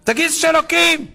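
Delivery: high-pitched yelled order; shouted demand. Retold or imitated. Terrorist shouting at the captive to confess, yelled demand. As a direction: yell it, forcing him to say it. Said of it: "אז הוא צועק אליו".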